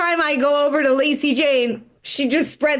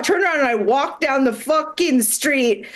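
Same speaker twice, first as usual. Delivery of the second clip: normal talking voice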